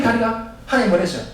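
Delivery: ordinary delivery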